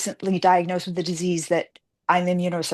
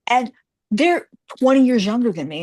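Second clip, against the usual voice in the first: ordinary delivery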